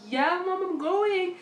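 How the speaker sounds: high loud beaming yes; happy confirmation. Retold or imitated. Daughter telling mom she is going, happy. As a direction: confirm it with joy.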